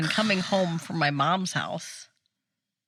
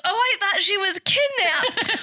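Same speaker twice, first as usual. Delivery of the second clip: put-on kid's alarmed line; 12 semitones higher than usual. Naomi's worried line retold.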